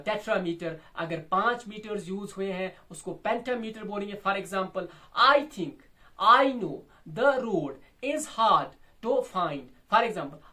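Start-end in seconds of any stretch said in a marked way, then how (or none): 5.3-9.6 "I think, I know, the road, is hard, to find" slow, paused after each pair; loud, measured scansion of "I think I know the road is hard to find"; two-syllable beats. Shown as a demonstration. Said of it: "दो syllable हो गया एक metre".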